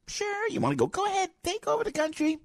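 very high-pitched, mocking go-ahead; sarcastic challenge. Radio host to those taking over the country, sarcastic challenge. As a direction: taunt them with a shrill fake invitation.

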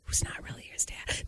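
hushed gossip whisper, breathy and unvoiced; whispered aside; secret whisper. Real, not acted. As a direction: lean in and whisper the scandal. Gossipy aside about family secret.

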